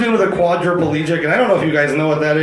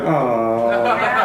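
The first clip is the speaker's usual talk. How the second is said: sympathetic aw; 4 semitones lower than usual. Retold or imitated. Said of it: "Rob's wife just went".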